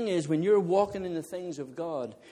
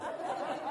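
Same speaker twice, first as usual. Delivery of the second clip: soft laugh; 7 dB quieter than usual. Real, not acted. Laughing at own bowling fall.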